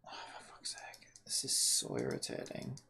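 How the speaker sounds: whispered, low exasperated grumble; frustrated whisper. Real, not acted. Streamer whispering his irritation at the game. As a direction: hiss it under your breath.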